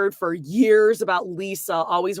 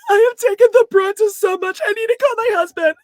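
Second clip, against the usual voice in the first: high, loud hammed-up wail; mock wailing Lisa; 12 semitones higher, 10 dB louder, faster than usual. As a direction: fake sob-story cry, rushing the end. Mocking Lisa running away upset.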